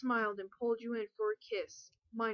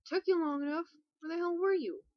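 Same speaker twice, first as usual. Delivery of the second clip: impatient; 7 semitones higher, 4 dB louder than usual. Acted. Impatient character line.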